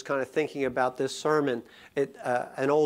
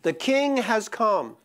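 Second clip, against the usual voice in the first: preaching at full force; 8 semitones higher, 5 dB louder than usual. Acted. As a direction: build the sermon to its peak with each phrase.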